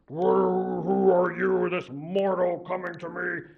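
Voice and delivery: loud, commanding chief's voice; imposing chief. Acted. Sun Chief questioning the mortal boy, loud commanding voice. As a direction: boom down at the intruder.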